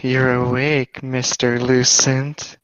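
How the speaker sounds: slow, low creepy stalker voice; creepy stalker lady. Acted. Sexy nurse greeting a waking patient, creepy stalker voice. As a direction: draw it out like a creepy, lustful stalker.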